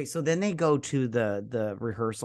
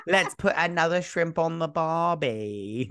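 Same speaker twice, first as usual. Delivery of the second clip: a light hammed-up Australian accent; mock Australian accent; 4 semitones higher than usual. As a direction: toss off a jokey Australian accent. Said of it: "That's Australian".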